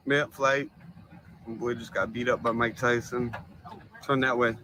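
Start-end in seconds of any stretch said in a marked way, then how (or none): none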